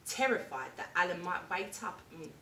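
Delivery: ordinary delivery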